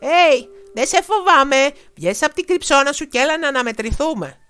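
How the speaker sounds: put-on loud, high brave boy's challenge; shout. A narrator's reading. Boy yelling at a hidden dragon, loud and high. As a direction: shout the dare from behind the axe. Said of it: "φώναξε".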